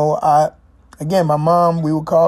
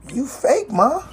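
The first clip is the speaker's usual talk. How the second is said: high, incredulous rising question; teasing question; 9 semitones higher than usual. Retold or imitated. Kid teasing mom about her work voice, high incredulous question.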